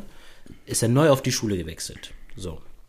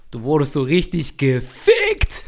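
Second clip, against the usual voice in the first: slow and deliberate, each word weighted; stressed delivery; 4 dB louder, slower than usual. Retold or imitated. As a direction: lay it down cold and emphatic. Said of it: "mit einer Betonung".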